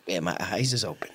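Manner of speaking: breathy whisper under his breath. Real, not acted. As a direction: murmur it just above a breath. Reading quietly to himself.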